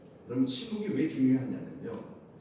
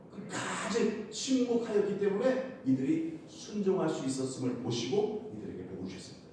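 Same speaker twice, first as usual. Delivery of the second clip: ordinary talk, nothing marked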